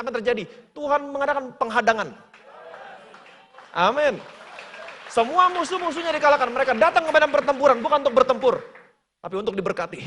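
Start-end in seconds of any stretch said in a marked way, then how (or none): 0.7-8.5 loud, high, driving proclamation; preacher rouses the congregation, loud; preaching at full force. Acted. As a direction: build the crowd up toward the victory.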